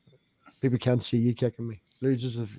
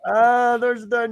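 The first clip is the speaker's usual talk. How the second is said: mock excited; 10 semitones higher, 5 dB louder, slower than usual. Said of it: "people be all".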